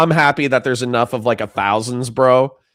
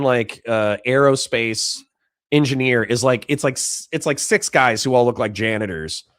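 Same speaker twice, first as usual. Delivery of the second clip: usual speaking voice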